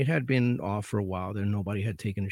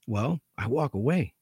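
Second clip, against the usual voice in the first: ordinary talk, nothing marked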